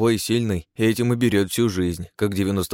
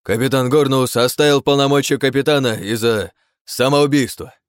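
raised, higher announcing voice; loud proclamation; 6 semitones higher, 6 dB louder than usual. Acted. Thin pirate announcing the captain's end to the crew, loudly proclaiming. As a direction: proclaim it to the whole deck.

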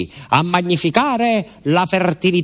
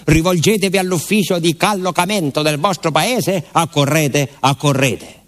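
proclaiming, slightly raised announcement read; reading a notice aloud; 4 semitones higher than usual. Retold or imitated. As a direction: read it like a town crier's notice. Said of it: "stava scritto il miracolo".

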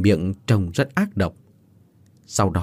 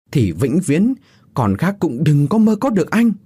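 raised, higher-pitched angry rant; jealous yelling; 5 semitones higher than usual. A narrator's reading. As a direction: rage at a lover who betrayed you. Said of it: "thét lớn".

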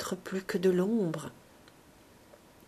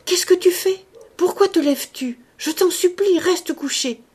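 high, loud frightened cry; alarmed cry; 10 semitones higher, 6 dB louder than usual. A narrator's reading. Ursus crying out to Dea as she rises, panicked. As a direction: call out in panic to stop her. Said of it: "Tout à coup, Ursus cria".

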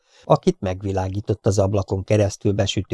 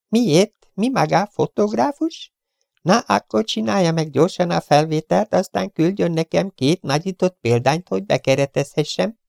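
high, curt, insolent gangster voice; rude Chiefen; 11 semitones higher, 3 dB louder than usual. Acted. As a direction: sneer the intruder off. (magas, pimasz, goromba hang)

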